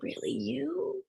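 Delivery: high, drawn-out mock disbelief, very soft; hushed disbelief. Mimicking people let down when you speak your mind, hushed disbelief.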